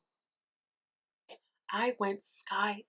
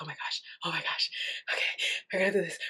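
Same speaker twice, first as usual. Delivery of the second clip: nervous whisper; much faster than usual. Retold or imitated. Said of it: "But you're like".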